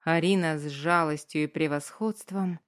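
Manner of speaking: ordinary delivery, nothing marked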